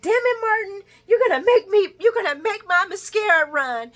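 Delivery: high, loud melodramatic gush; mock teary Lucy. Lucy tearing up over Martin, high and hammed up. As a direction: ham up the tears.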